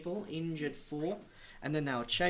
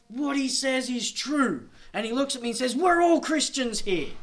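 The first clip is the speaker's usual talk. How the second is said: proud village host; 9 semitones higher, 8 dB louder than usual. Retold or imitated. Host telling the missionary all are Christians, high proud proclamation. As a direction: proclaim it proudly to the whole family. Village man vouching at the meeting.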